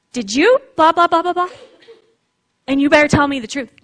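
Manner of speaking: mock entrapping grilling, the last line fast; accusing interrogation. A parent grilling a child to trap them, accusing. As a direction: corner them with a suspicious question. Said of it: "it's not entrapment".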